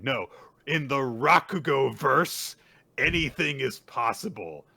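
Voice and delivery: loud, high, slow dramatic declaration; hammed-up triumphant announcement; grand proclamation. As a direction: draw out the big reveal, then land it. Acts character's grand realization.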